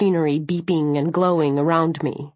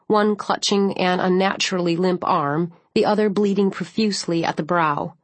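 usual speaking voice

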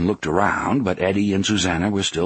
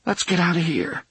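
trembling voice; 10 semitones higher than usual. A narrator's reading. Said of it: "in a trembling voice".

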